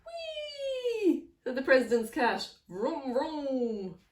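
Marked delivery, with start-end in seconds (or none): 0.0-1.3 high, drawn-out whee; high-pitched playful cheer; gleeful squeal. Acted. Cat enjoys the motorbike ride. 2.7-4.0 drawn-out motorbike vroom; playful spoken engine noise; imitated engine revving.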